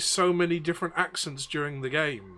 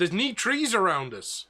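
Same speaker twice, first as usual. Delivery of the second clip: lilting captain in mock Belfast, higher; bad Belfast accent; 4 semitones higher than usual. Captain pointing out they're at sea, bad Belfast accent. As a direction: put on a poor Belfast accent. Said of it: "absolute worst Belfast accent I've ever done".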